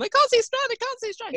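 high-pitched mock panic, repeated in a rush of alarm; panicking. Rider retelling her panic to the trainer mid-approach, panicking. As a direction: squeal it like a rider losing her nerve. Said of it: "And I'm panicking".

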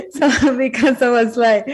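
talking through laughter; laughing as she speaks. Real, not acted. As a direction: let the laugh spill into the words. Laughing while recalling the moment.

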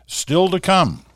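upbeat coming-up-next read; announcer tease. Acted. Show tease before break.

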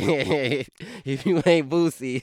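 high, loud words through laughter; amused, laughter breaking into the words; laughing. Real, not acted. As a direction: crack up while talking. Laughing at own [name] line.

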